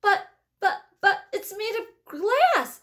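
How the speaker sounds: high, stammering alarm; panicked shout. A narrator's reading. Grandpa Joe warns Wonka the elevator is glass, panicked stammer. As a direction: sputter in disbelief. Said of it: "shouted Grandpa Joe".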